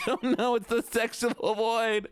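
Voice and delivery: high, slow whiny wail. Pretend. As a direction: whine it out, drawn and high. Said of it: "I need to do the whiny voice".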